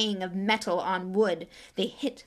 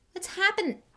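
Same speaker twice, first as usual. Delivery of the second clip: high, startled gasp of a question; 11 semitones higher than usual. Acted. Ron asking Harry after the crash, gasping. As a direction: ask in shock. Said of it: "Ron gasped".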